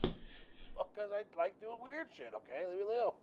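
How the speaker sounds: teasing huff of leave me alone; mock defensive. Real, not acted. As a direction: play the wounded hobbyist. Defensive jokey retort.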